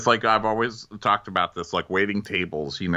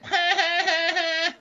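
high, staccato put-on cackle; exaggerated high-pitched laugh; mock cackle. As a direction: cackle long and high at your own jibe. Acting Kenya's laugh at own jibe.

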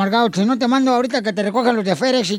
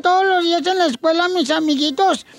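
put-on higher, slow, weepy girl's voice; crying little daughter; 5 semitones higher than usual. Daughter tells dad the kids throw corn at her, high and weepy. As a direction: whimper the complaint like a teased child. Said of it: "(daughter's reply)".

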